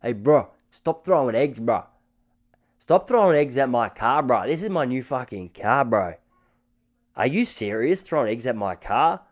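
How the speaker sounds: imitated Lebanese bro protesting; Lebanese accent. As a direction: do an indignant Lebanese-Australian man, accent first. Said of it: "how does a Lebanese accent go?".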